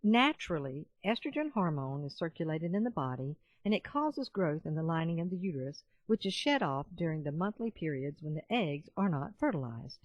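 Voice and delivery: ordinary delivery, nothing marked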